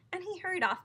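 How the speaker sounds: usual speaking voice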